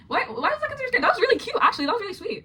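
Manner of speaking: high, incredulous squeal; high disbelief. Real, not acted. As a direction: react as if it's absurd. Disbelief at scary category.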